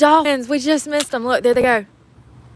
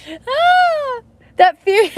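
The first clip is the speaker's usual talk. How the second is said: mock shriek; 4 dB louder, much slower than usual. Real, not acted. Reacting to scary fish teeth.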